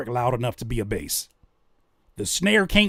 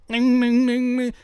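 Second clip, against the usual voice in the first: synth imitation; 9 semitones higher, 4 dB louder than usual.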